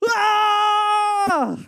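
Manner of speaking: long high mock scream, held; a hammed-up terrified wail. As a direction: scream like bats hit you. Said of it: "He screams".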